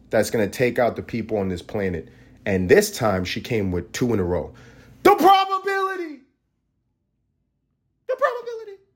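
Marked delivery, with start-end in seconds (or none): 5.0-8.8 very high squealed exclamation; mock falsetto cry. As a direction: shriek it way up in falsetto.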